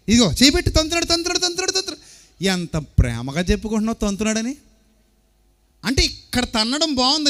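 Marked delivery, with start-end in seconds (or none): 0.1-2.1 high, thrilled voice of an expecting mother; a mother squealing with joy over the kick; delighted mother. Acted. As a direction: gush about the baby kicking, pitched up high.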